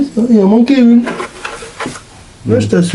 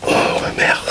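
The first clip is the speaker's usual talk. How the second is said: whispered curse; 6 dB quieter than usual. Real, not acted. Annoyed at stuck part.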